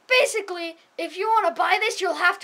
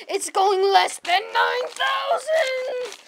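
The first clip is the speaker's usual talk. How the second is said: slowed panicked wail; 6 semitones higher, slower than usual. Acted. Power failing.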